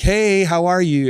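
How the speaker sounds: high, drawn-out mock hello; mock cheery greeting. Husband imagining his wife waking wide-eyed, mock cheery. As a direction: exaggerate a perky, slow greeting.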